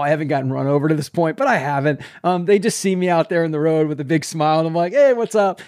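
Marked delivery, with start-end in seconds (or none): 4.9-5.6 higher-pitched, casual call-out; imitated carefree street greeting; breezy greeting. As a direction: toss out a cheery hello to passing drivers. Acts out greeting drivers from the road.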